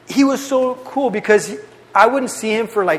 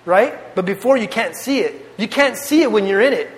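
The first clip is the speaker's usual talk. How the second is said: usual speaking voice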